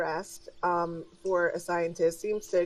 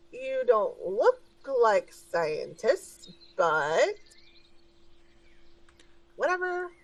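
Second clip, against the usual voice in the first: couldn't-care-less underpaid receptionist; bored indifference; 6 semitones higher than usual. Acted. Receptionist waves off doubts about the visitors, bored and indifferent.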